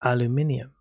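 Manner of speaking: British banana, aluminium; British accent. Shown as a demonstration. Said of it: "do Kaiser a favor and say banana".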